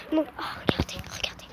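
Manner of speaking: hushed whisper. Real, not acted. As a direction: whisper so the dog stays calm. Sneaking up on a dog.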